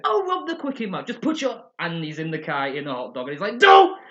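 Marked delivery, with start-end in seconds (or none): 0.0-1.6 excited shout. Retold or imitated. Homer announcing robbery. 3.6-4.0 big shout. Retold or imitated. Homer shouting in car.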